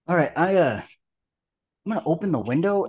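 normal talking voice

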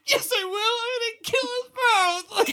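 put-on crying child's threat, slowed and high; retold crying. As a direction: whine it out like a sobbing second grader. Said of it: "And I start crying".

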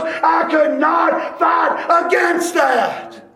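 rising from lively to high, loud shouting; preaching that climbs to full force; building preaching. Acted. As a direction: build it up to a pulpit roar.

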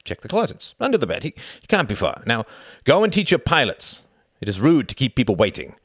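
barking orders, impatient; commanding count. Acted. Villain giving orders.

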